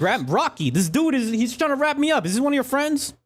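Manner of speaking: high, panicked complaint; panicked npc. Acted. Npc squealing to Sohar about being tied up. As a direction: squeal for help as you're tied.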